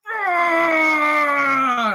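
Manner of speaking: loud, high raw scream of frustration; anguished yell. Retold or imitated. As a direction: let out a cry of rage and despair. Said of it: "I was like".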